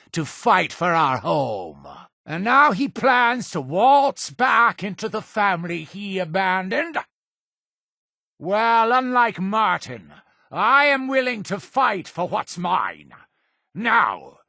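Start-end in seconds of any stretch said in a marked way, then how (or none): 2.2-13.1 high-pitched, outraged tirade; indignant rant. Acted. Leader condemning Martin who abandoned the family, outraged rant. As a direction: rail against the deserter.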